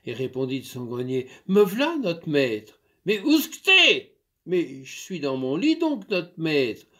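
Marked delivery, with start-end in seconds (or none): 1.5-2.8 a light touch of a country maid, called out; eager maid. Acted. Maid answers from attic. 3.0-4.2 loud, high shout up the stairs; angry shout. Acted. Master shouts up. 4.4-6.9 a light touch of a country maid, called out; eager maid. Acted. Maid replies.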